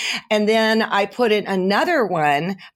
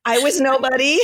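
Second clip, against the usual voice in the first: laughing echo; 5 semitones higher than usual. Real, not acted. Echoing friend's line, laughing.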